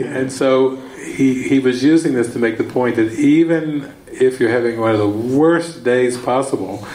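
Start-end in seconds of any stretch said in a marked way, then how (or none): none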